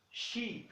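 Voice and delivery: "Sheep" with a soft sh and long ee; soft sh, hold the ee in "Sheep"; sheep demo. Shown as a demonstration. Teacher models sheep for students, sh sound and long vowel. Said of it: "O S H tem som de X".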